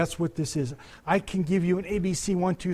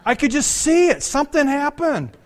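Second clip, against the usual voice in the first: excited wonder; 9 semitones higher, 8 dB louder than usual. Real, not acted. Still excited after retold scene.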